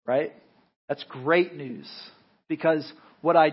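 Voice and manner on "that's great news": normal talking voice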